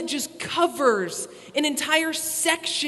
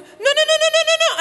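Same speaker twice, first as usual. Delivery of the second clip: much higher, louder frantic no's; panicked little voice; 14 semitones higher, 7 dB louder than usual. Retold or imitated. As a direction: squeal the no's like a startled host mom. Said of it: "I hear this little".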